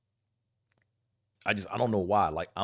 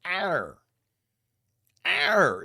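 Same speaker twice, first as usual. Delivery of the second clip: high, loud, strained "R"; overdone hard R; 10 semitones higher, 6 dB louder than usual. Retold or imitated. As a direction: force the R out hard. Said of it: "it takes a lot of energy from them to say".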